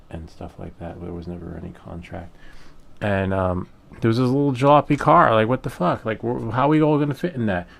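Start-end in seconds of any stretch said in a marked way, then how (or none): 4.0-7.7 louder, higher exasperated retelling; loud, incredulous griping; indignant disbelief. Retold or imitated. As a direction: vent in outraged disbelief. Indignant at tiny jalopy sent.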